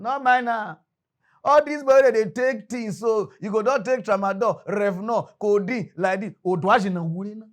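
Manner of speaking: loud, high police bully listing drugs; hammed-up bullying police officer. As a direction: bark it like a corrupt cop rattling off drugs.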